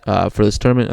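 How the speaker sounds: ordinary talk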